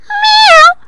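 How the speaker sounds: high meow; cat meow. A narrator's reading. Tickled cat meows at the witch. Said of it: "said a cat".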